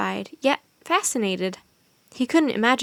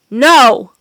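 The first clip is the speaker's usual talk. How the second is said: a huge, much louder no; cried out; 13 dB louder than usual. A narrator's reading. Wizard cries out as the wolves howl. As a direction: hurl the word out in anguish. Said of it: "cried the wizard".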